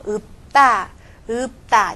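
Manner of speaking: 읍다 with a stopped p, then tense 따; right-side consonant. Shown as a demonstration. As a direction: close the lips on 읍, then say 다 tensely in 읍다. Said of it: "这么说啊". (읍다读右边代表音ㅂ)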